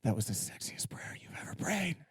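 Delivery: seductive whisper in his ear; wife whispers to husband, breathy. Retold or imitated. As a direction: whisper close, breathing heavy. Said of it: "breathe a little heavy".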